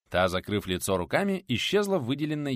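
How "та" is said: ordinary delivery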